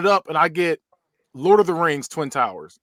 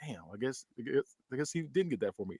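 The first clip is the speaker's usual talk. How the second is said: put-on low, soft, let-down kid; mock dejection; 6 semitones lower, 16 dB quieter than usual. Son seeing no PS two, mock dejection. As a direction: drop it low and soft, resigned. Acts out kid's letdown.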